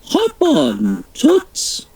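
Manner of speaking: breezy royal invite, swooping up; light regal sing-song; the Queen. Acted. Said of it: "said the Queen".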